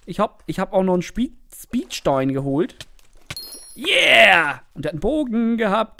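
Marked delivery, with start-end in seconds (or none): 3.8-4.6 triumphant cheer. Real, not acted.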